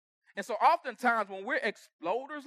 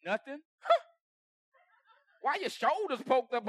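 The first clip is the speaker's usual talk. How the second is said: ordinary talk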